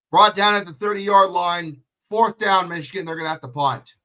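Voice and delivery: fast sportscaster call, rising to a loud peak on the sack; play-by-play call. Acted. Live play-by-play of the game.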